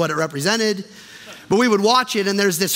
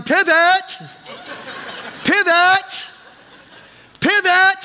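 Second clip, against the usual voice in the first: high-pitched comic yell, punching each repeat; Ross yelling; 11 semitones higher, much slower than usual. Acted. Pastor acting out the couch scene from Friends, yelling. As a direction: yell it like a frantic sitcom mover. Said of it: "he's yelling".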